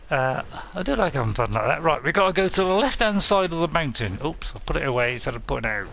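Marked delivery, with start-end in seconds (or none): none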